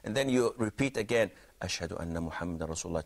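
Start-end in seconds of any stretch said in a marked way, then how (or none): 1.6-3.1 soft, low, rapid recitation. Shown as a demonstration. Teacher showing the second shahada said twice, softly. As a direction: recite it quickly under your voice. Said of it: "in a soft voice".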